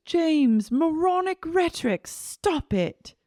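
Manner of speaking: high-pitched imitation of a scolding; Lisa. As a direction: scold in a put-on high voice, dropping at the end. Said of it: "Lisa goes".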